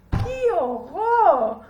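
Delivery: loud, drawn-out disgust; loud wail of horror; horrified. A narrator's reading. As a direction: stretch out the revulsion. Boy faces dirty raw ear.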